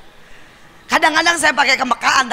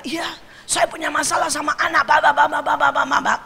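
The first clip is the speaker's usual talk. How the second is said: higher voice running into babble; imitated complaining neighbour; 4 semitones higher than usual. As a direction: mimic someone pouring out troubles, ending in blah-blah syllables. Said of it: "dia mulai cerita". (menirukan ibu curhat nyerocos)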